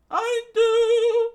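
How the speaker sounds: very high, loud falsetto; squeaky eager answer. Acted. Character saying she can cook, in a high squeak. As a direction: chirp it out proudly in a tiny voice.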